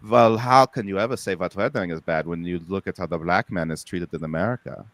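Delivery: low, fast villain impression; Nazi officer voice. Comic imitating a Nazi's excuse, low fast voice. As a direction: sneer like a smug Nazi. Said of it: "They'd be like".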